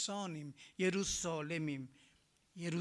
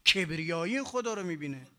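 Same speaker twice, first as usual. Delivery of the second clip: normal talking voice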